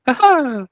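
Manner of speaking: high, loud gloating laugh; hammed-up taunt; mock taunting laugh. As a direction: cackle at him like a prankster. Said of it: "and I'm like".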